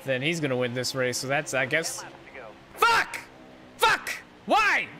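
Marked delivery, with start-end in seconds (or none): 2.7-4.9 frustrated yell. Real, not acted. Frustrated at game.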